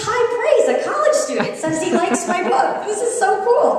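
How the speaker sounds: high, giggly and thrilled; excited. Retold or imitated. Author gushing to the audience, high and laughing. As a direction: squeal with delight over the praise.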